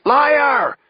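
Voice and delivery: loud, high accusing yell; outraged woman's shout; crying out. A narrator's reading. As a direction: hurl the accusation across the courtroom. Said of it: "one woman cries out".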